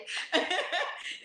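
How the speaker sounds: high airy laugh under the breath; breathy laugh. Real, not acted. Guest laughing at her own joking goodbye to auntie, airy high laugh.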